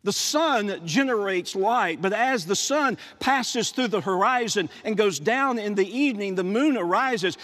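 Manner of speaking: usual speaking voice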